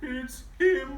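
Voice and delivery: high, loud whimper; whimpering elephant. Acted. Elephant whimpering as Big Bro arrives. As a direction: whimper it fearfully. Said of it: "whimpered the elephant".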